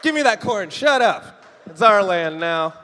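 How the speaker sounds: loud, high greedy snarl; colonist bark. Acted. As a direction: seize the land, bullying.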